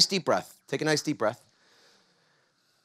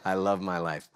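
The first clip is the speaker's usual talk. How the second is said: low and calm; settled, gently spoken conviction; sincere affirmation; 8 semitones lower, 4 dB quieter than usual. Shown as a demonstration. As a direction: lead them in a low, heartfelt affirmation. Repeats affirmation with the audience.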